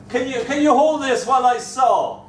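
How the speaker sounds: high, loud fatherly request; calling out. Retold or imitated. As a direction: imitate a dad calling over for a hand. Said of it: "he'd call".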